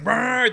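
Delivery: engine brrr. Pretend. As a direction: rumble like a big motor. Imitating an engine rumbling.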